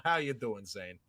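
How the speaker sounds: normal talking voice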